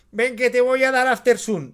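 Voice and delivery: put-on a mother calling her kid for sunscreen; mock mum yelling across the beach, after soon; imitating mum at the beach. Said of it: "nuestras madres en la playa".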